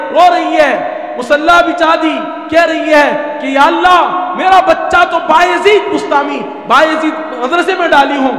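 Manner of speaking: loud, high, fervent preaching; impassioned preaching. Acted. Preacher telling of the weeping mother's prayer, high and fervent.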